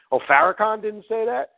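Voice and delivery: high, rising incredulous question; mock disbelief. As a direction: mimic a scandalized follower. Mimics disbelieving followers.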